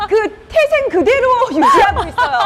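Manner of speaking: laughing while talking; words breaking into a real laugh. Real, not acted. Laughing through the pitch.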